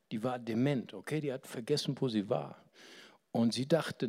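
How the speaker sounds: ordinary delivery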